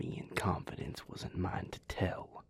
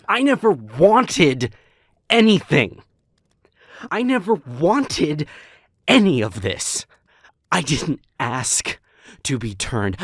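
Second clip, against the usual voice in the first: furious shout; 5 semitones higher, 14 dB louder than usual. Acted. Vampire screaming at Porter about being turned, furious shout. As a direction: let it all explode in rage.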